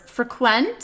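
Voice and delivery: free-KWENT, stress the end of "Frequent"; British pronunciation. Shown as a demonstration. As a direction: lean on the second syllable of "Frequent". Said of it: "those are my top favorite words".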